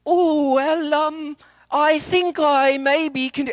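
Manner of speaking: high, slow, faltering Irish accent attempt; bad Irish dialect. Student asked to speak Irish dialect, faltering high Irish accent. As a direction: fumble a weak Irish accent. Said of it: "put Irish dialect".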